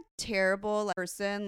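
usual speaking voice

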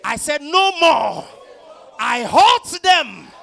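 high, shouted, building preacher fervor; righteous paladin declaration. Acted.

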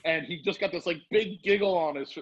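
ordinary talk

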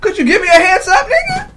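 high laughing protest with a shout on the end; laughing outrage. Real, not acted. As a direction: laugh while you protest.

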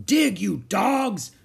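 high, drawn-out bellow; slow, loud pirate command; shouted order. A narrator's reading. Captain to his digging crew, slow shouted order. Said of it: "cried Captain Bones".

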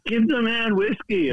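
high, slow hammed-up call; demanding whiskey in a half-joking shout; mock yell. Narrator playing a passenger calling for whiskey, as a high mock shout. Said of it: "I'm screaming".

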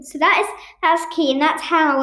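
ordinary delivery

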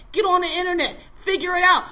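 sudden loud bark at the listener; angry shout. Real, not acted. Ranting at non-voters, a loud exasperated yell. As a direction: yell it out in frustration.

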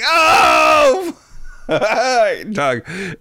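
high, loud imitated gasp-yell; mock shocked yelp. Girl's shocked yell retold.

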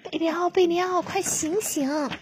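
high, urgent call to wake him; high-pitched urgent calling. Acted. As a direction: call out to rouse a sleeping friend. Waking a friend urgently.

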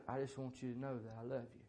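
hushed, gentle aside; soft whispered words. Retold or imitated. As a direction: say it close, just for his ear. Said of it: "he whispered in his ear".

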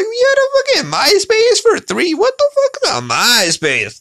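cartoonish high-pitched shock at auntie; squeaky falsetto disbelief. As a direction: shriek it in mock outrage. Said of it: "And I'm like".

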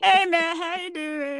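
high, stretched puppet greeting; high-pitched cartoonish greeting; Sesame Street character. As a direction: mimic a kids' show puppet saying hello. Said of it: "sound like a Sesame Street character".

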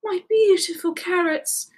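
high-pitched adoring cry; joyful, lilting rabbit; excited. A narrator's reading. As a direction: gush over your treasure. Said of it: "running over in excitement".

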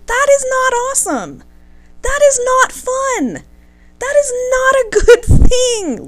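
high, insistent lecturing, each word hammered; emphatic scolding. Real, not acted. Scolding a man who won't take no, high and emphatic. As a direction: scold him, spelling it out. Ranting at a pushy suitor.

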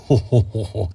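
booming ho-ho-ho laugh; put-on giant chuckle; giant's laugh. Giant host laughs at own question.

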